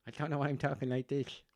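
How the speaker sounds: put-on quick, high-pitched Offaly farmer accent. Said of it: "a farmer from Offaly".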